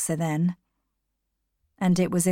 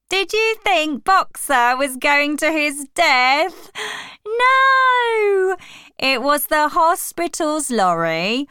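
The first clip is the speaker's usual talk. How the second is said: slow, theatrical, swooping oratory; Squealer, indignant; 4 semitones higher, 4 dB louder, slower than usual. A narrator's reading. Squealer persuades the doubting animals, overblown indignation. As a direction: explain it away with exaggerated indignation.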